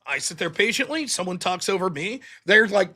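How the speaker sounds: ordinary talk, nothing marked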